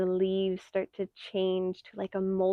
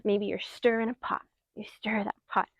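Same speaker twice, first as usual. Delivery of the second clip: ordinary talk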